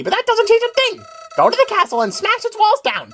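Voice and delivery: scheming villain flower. Acted. Villain flower berating his partner, sneering then betrayed. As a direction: scold the partner, then turn to betrayed outrage.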